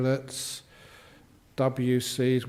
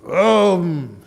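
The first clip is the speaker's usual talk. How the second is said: engine vroom; 3 semitones higher, 8 dB louder than usual. Retold or imitated. Imitating a powerful sports car.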